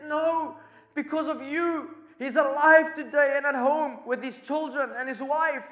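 the general sobs out his grief at the nurse's feet; anguished, high, tearful outcry; weeping. Retold or imitated. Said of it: "starts weeping".

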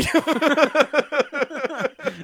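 long, high peals of laughter; burst of laughter. Real, not acted. Host laughing at tragedy, high helpless laughter. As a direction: laugh giddily, out of control.

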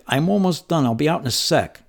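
put-on a six-year-old shouting he's nearly done; little boy calling out. Boy calls to Laura through the bathroom door, high and loud.

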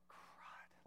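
whispered under the breath; hushed, voiceless aside of dread; dismayed whisper. Retold or imitated. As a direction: whisper it like a sinking realization. Dismayed inner reaction to serious look.

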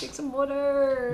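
put-on Jersey vowels; New Jersey accent. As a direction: stretch water like a Jersey local. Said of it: "New Jersey".

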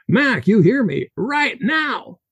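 put-on high Russian-accented shout on right now. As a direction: yell it at him in a heavy Russian accent. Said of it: "His Russian accent heavy".

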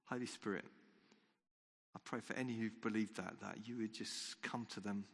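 quiet prayer. Acted. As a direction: pray gently, almost under the breath. Leads a quiet closing prayer.

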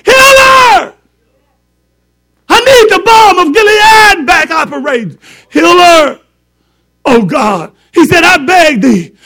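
loud, high pleading cry; Moses' plea. Retold or imitated. Preacher voicing Moses crying to God.

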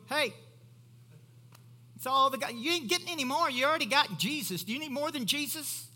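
high, loud mock heckle; mock interruption. Mock interrupting a prayer meeting.

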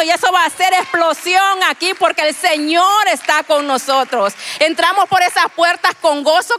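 predicación fervorosa. Acted. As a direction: proclaim it to the congregation. Predicando a toda voz. (predicando a toda voz, más fuerte y más agudo)

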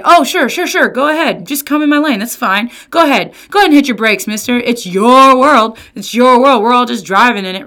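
loud, high mock-cheerful sarcasm; sarcastic. Driver mocking a reckless lane-cutter, loud sarcastic fake cheer. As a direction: wave them on with biting fake politeness. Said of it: "And what do we say?".

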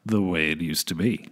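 deep announcer voice. Acted. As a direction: boom out the title like a radio host. Announcing the game title.

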